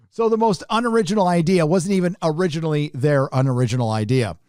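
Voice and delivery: ordinary talk, nothing marked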